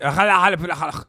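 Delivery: throaty run of nonsense syllables; mock Welsh gibberish. Streamer proves they did the Welsh accent, throaty mock gibberish. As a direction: gargle fake Welsh "hala-hala" sounds. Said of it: "do a fucking Welsh accent".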